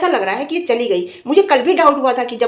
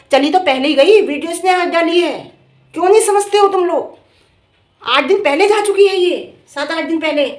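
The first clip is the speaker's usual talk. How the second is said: loud, high-pitched exasperated scolding; झुंझलाकर डाँटना; 6 semitones higher, 7 dB louder than usual. Real, not acted. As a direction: raise your voice at people who won't understand. Scolding public for not understanding. (झुंझलाहट में ऊँची, तेज़ आवाज़ से समझाना)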